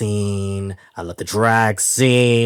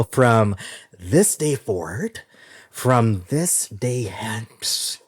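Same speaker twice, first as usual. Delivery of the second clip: drawn out slowly like a wedding vow; mock solemn vow; 2 semitones higher, much slower than usual. Announcing the new format, slow mock vow. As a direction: stretch each word, mock ceremonious.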